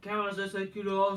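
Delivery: ordinary talk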